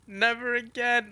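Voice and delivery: high, slow, loud wail; mock tearful vow. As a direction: wail it out, imitating a weeping woman. Voices crying woman from manga.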